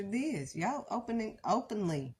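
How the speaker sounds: usual speaking voice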